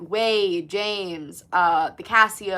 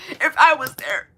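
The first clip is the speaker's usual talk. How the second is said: If I was there pushed up high and louder; high, strained; 8 semitones higher, 6 dB louder than usual. Shown as a demonstration. Repeating her own line, now high and loud.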